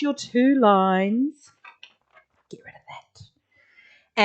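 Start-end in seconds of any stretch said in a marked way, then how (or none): 2.5-4.2 whispered aside. Real, not acted. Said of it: "whispered aside while drawing".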